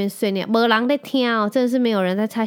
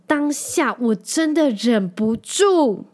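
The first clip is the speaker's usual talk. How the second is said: drawn-out confession; 4 semitones higher, 4 dB louder, much slower than usual. Real, not acted. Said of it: "我真的忍不住说了".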